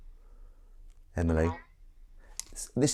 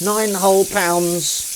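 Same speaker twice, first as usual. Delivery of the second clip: slow, higher Nine whole pounds; stress on whole; 10 semitones higher, 6 dB louder, much slower than usual. Real, not acted. Mocking the price, slow stressed outrage.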